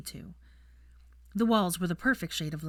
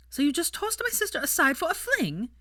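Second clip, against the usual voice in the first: high, louder indignant woman's voice; accusing sister; 12 semitones higher, 4 dB louder than usual. A narrator's reading. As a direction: throw the accusation at him. Daphne accusing Draco over her sister.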